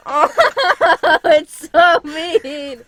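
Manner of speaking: loud, high, drawn-out wail through laughter; laugh-choked, stretched-out words; real laughing protest. Real, not acted. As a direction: protest while cracking up. Laughing at picture of herself.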